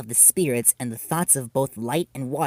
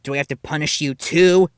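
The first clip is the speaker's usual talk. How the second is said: louder menacing warning; threatening shout; 4 semitones higher, 8 dB louder than usual. Acted. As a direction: threaten her with a karate chop. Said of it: "shouted Nibutani".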